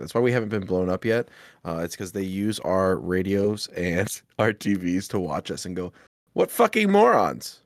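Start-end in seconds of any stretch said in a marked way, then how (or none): 6.3-7.6 high, loud, slow mocking alien. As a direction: sneer down at humans like an alien. Aliens voiced mocking humans.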